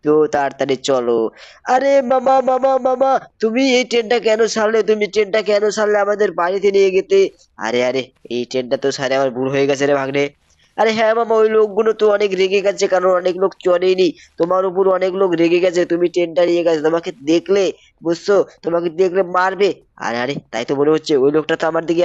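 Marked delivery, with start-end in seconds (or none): 1.7-7.2 put-on high child voice whining at uncle; high cartoon boy asking why the train left; cartoon kid nephew. Nephew complains about train. 10.8-19.7 put-on a bit higher child voice warning; hint of the worried nephew; slightly raised boy voice warning uncle of angry people; lightly voiced kid nephew.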